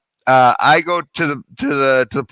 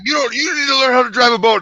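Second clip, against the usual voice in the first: high-pitched mock scolding, not truly shouted; retold angry yelling; 9 semitones higher than usual. Angry boater scolding the driver, high mock yell. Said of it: "he kept yelling at me about it".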